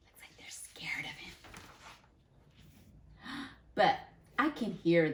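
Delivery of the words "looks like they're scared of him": very soft conspiratorial whisper; hushed whisper. Acted. Teacher whispering an aside to the kids, hushed. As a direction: lean in and whisper it.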